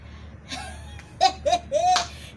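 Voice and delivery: a burst of real laughter; laughing. Real, not acted. Laughs at clip.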